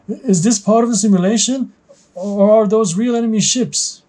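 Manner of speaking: high-pitched, rattled pilot. Acted. Trainee pilot over the radio spotting hostile ships, rattled. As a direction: pitch it up high, alarmed and unsure. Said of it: "A rattled voice is heard over the radio".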